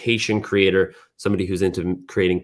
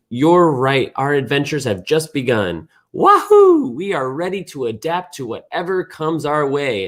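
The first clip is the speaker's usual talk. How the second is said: excited hero; 6 semitones higher than usual. Acted. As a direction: cheer like a cartoon space hero setting off. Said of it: "He says".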